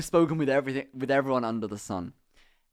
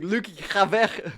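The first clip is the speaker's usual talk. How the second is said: mock Dutch friend; 5 semitones higher, slower than usual.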